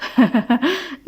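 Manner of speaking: short playful chuckle; teasing laugh. Acted. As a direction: laugh at your own tease. Fares teasing Nada. (ضحكة مشاكسة)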